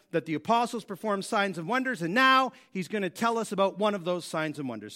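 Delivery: ordinary talk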